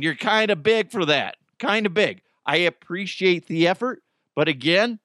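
ordinary delivery

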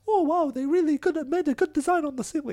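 breezy fake wonder to dodge the bill; imitating the date. Man imitates the date ignoring the bill, high and fast.

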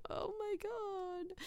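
breathless laughing aside, soft and high. Real, not acted. Laughing at herself.

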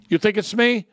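ordinary talk, nothing marked

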